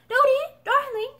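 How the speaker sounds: put-on high, loud child's call; eager child call. A narrator's reading. Child calling his mother back eagerly. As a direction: call her back eagerly, like a small boy.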